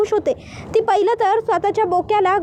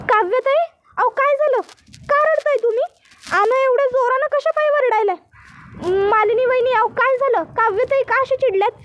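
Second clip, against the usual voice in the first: higher, anxious rustic maid asking what happened; village maid voice; 6 semitones higher than usual. Acted. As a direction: play the worried housemaid in dialect. Servant Asha voiced in recap.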